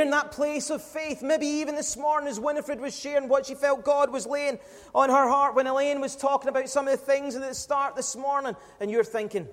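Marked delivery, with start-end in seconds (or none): none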